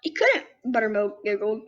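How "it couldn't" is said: squeaky little bunny asking, higher pitch. Acted. Bunny questions the skunk, high and curious. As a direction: play a small excited bunny, pitched up. Buttermilk speaks.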